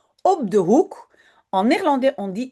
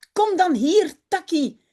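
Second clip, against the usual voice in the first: higher call to the dog, a touch of a shriek; 7 semitones higher than usual. Acted. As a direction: cry out for the little dog. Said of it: "gilt Janneke".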